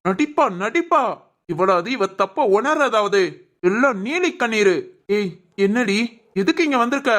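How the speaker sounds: bitter, contemptuous scolding; scornful anger. Acted. Husband dismissing wife's tears as acting, scornful. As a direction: sneer at the tears, then order her out. Father scorns crying wife.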